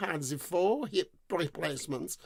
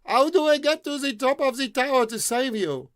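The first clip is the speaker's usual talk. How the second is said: high, loud call upward; shout up; 8 semitones higher, 6 dB louder than usual. Acted. The prince shouts up the tower to the maiden. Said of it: "shouted up".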